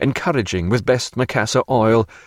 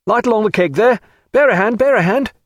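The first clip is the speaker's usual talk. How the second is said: high, urgent orders to the crew; raised, commanding call; 11 semitones higher, 4 dB louder, faster than usual. A narrator's reading. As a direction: call out briskly to the boatmen. Officer calling orders to boat crew.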